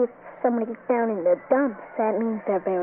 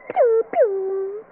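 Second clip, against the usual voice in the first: two short mock pew noises, the second higher; silly pew sounds; 8 semitones higher, 4 dB louder than usual. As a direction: pop out two cartoon pews. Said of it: "and go".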